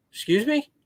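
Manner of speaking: high, rising incredulous question; baffled double-take; retold disbelief. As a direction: act out being taken aback, pitch jumping up. Retold baffled reply to odd question.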